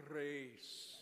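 "Grace" with a rolled R. Shown as a demonstration. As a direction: demonstrate "Grace" with a trilled R. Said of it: "So roll that R".

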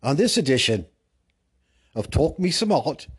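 high, booming British presenter; hammed-up British announcing, loud and grand; mock British host. Host presenting a look at the Brits, mock British.

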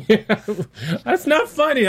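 high giggling through the words; laughing. Real, not acted. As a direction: laugh while protesting. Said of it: "I'm laughing".

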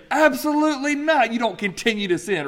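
high-pitched, forceful outburst of refusal; emphatic refusal. Acted. Preacher voicing Paul's rejection of continuing in sin, emphatic. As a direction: shoot it down emphatically, voice leaping up. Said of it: "it was an emphatic phrase to say".

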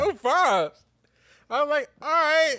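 high, loud incredulous all right; mock disbelieving shout. Shocked at the no-fives rule, a high mock shout.

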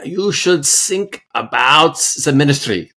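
a bad Arnold Schwarzenegger-style German accent. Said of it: "say that one sentence with the accent".

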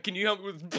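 high plea broken by laughter; laughing NPC voice. Retold or imitated. Replaying the NPC's last words, laughing. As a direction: mimic him while cracking up.

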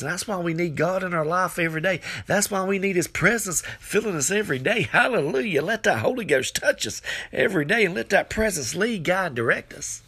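high, insistent sermon questions; fervent preaching. Real, not acted. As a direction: press the question home from the pulpit. Preaches with fervour.

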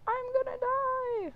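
high-pitched, slow panicked squeal; panicked wail. Retold or imitated. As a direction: wail it out like you're doomed. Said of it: "I was like".

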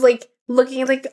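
ordinary talk, nothing marked

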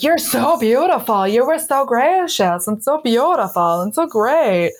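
high, sing-song mock gushing; gushing admiration. Audience member turns to the thinner colleague, gushing mockingly. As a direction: swoop up on every compliment, imitating a fawning fan. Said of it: "have a completely different attitude".

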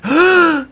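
sharp high gasp for air; demonstrating gasping for breath; acted gasp. Shown as a demonstration. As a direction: gulp air like you can't breathe. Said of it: "you could barely breathe".

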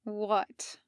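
"What?" with a falling, flat tune; "What?" said soft, as a statement; flat not a question. Acted. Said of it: "not really a question".

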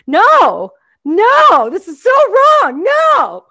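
mock furious rant. Comedian ranting at a health ad, furious. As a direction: ham up outrage at the ad. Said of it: "I get so mad".

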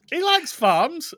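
squeaky slowed joke voice; high, slow silly voice. Pretend. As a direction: say it high and drawn out. Joke about [name].

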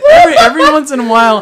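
loud, fast, crying with laughter; laughing through words. Real, not acted. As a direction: push the words out while still laughing. Laughing through words after outburst.

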